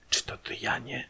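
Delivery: hushed, voiceless whisper; cautious whispered question; furtive whisper. A narrator's reading. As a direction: whisper it secretly into the dark. Furtive nighttime question at door.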